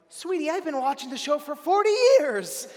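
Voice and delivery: put-on high, loud old-lady voice; emphatic, high-pitched granny impression; sweet old lady. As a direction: imitate an old lady proudly setting someone straight. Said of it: "this old lady, really sweet".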